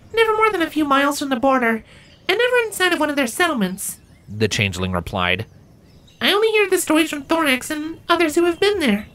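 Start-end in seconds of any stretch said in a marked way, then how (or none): 0.1-4.0 much higher creature voice, wondering; a shy outsider asking her friend; very high, curious little creature; Tibia, curious changeling. Acted. 6.2-9.1 much higher creature voice, wondering; a shy outsider asking her friend; very high, curious little creature; Tibia, curious changeling. Acted.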